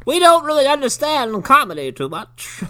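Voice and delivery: high, odd granny; deadpan the confession as an old woman; old lady voice. Retold or imitated.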